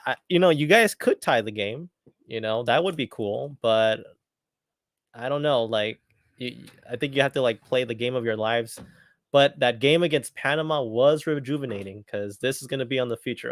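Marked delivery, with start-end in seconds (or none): none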